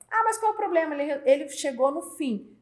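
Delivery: high-pitched mock protest; imitated protest. Employee defending the other route to the boss, high-pitched protest. Funcionário minimiza a desobediência.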